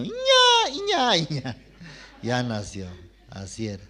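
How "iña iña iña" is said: very high imitated baby wail; imitating a newborn's first cry, very high. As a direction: mimic a newborn crying. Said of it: "ya gritó el niño".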